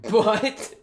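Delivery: high, loud laughing outburst; burst of laughter. Real, not acted. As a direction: crack up out loud at the screen.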